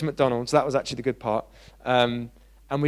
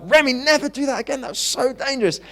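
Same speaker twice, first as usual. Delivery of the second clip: put-on raised, much higher stern warning to a child; firm warning; 10 semitones higher than usual. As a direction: scold him sharply for running into the road. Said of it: "He needed a firm warning".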